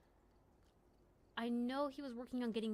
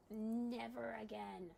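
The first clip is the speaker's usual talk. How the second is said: slow drawn-out; much slower than usual. Real, not acted. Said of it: "repeat of never again".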